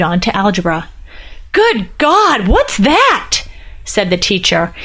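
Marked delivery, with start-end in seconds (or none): 1.5-3.4 shocked exclamation. Acted. Teacher shocked at goat head.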